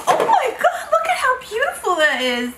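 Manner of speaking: high-pitched thrilled exclamation; real gushing astonishment; amazed delight. Real, not acted. Amazed at the card.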